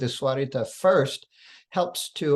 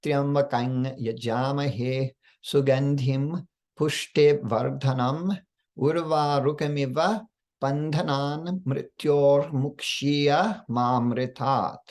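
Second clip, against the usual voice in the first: slow even recitation, slight pause between words; every syllable distinct and steady, words set apart; slow Sanskrit recitation with clear word breaks; mantra with pauses; slower than usual. Shown as a demonstration. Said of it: "listen to the slight pause between words".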